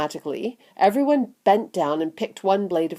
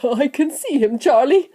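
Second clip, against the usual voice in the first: raised, eager grandfather voice; mild excitement; 7 semitones higher, 4 dB louder than usual. A narrator's reading. Grandpa Joe spotting the little man for Charlie, excited. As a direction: answer with a touch of excitement. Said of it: "said Grandpa Joe excitedly".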